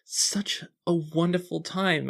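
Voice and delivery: put-on high, fast, eager girlish gush; gushing teen girl. GM voicing the tipsy sister gushing to Juliana, high. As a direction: gush at your new friend.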